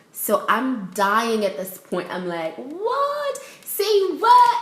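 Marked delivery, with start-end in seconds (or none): 2.6-4.6 very high-pitched, incredulous squeal; shocked disbelief. Retold or imitated. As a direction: act out being floored by news. Retold shocked reaction.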